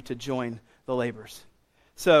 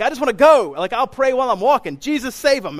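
high, loud, raring to go; impatient type A; 7 semitones higher, 6 dB louder than usual. Acted. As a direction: mimic an impatient go-getter. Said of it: "all you type A's are like".

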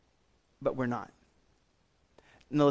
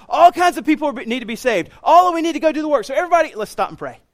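high, loud, accelerating call to action; rally everyone urgently, speeding up; rousing rally; 9 semitones higher, 7 dB louder, faster than usual. Acted. Acts rousing Jesus rallying the disciples.

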